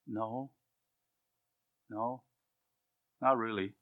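hushed, modest no; humble quiet reply. Acted. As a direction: answer softly, brushing off praise.

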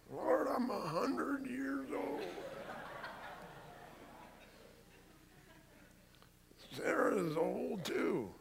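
high, slow, hammed-up old man voice; Abraham. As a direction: play a hundred-year-old man, drawn out and incredulous. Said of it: "Remember Abraham?".

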